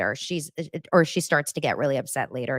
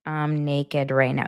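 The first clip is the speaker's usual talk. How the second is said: slow, deliberate dictation; typing aloud; slower than usual. Real, not acted. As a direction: say it slowly as you type a text. Saying the text while typing it.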